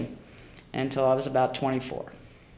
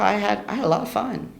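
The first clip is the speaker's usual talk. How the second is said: ordinary talk, nothing marked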